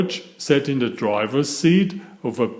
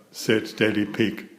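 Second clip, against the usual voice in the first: usual speaking voice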